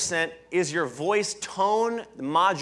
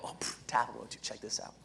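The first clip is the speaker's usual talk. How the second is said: whispered nudge to a neighbour; hushed aside; 8 semitones lower, 14 dB quieter than usual. Acted. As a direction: whisper it like you've just spotted something.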